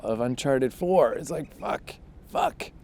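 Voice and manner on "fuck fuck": a soft, pained curse that jumps up high; dismayed cry under the breath; frustrated groan. Real, not acted. Frustration over Uncharted four.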